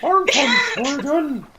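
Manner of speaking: slow, high mock Swedish accent. Suspect telling police where he is from, fake Swedish accent. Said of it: "That's my Swedish accent".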